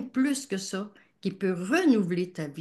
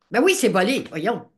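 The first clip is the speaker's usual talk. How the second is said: loud retort; 3 semitones higher, 8 dB louder than usual. Retold or imitated. Husband retorting.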